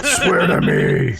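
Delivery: high, strained Batman parody; hammed-up superhero demand. Player capping the Batman bit, hammed-up demand.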